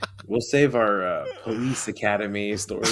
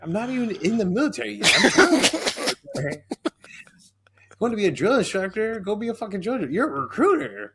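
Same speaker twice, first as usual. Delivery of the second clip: angry retort; 10 semitones higher than usual. Retold or imitated. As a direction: fire back at the recruiter who yelled. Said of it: "she's, like, yelling at me".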